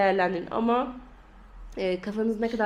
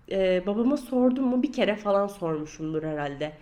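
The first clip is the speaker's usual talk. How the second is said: usual speaking voice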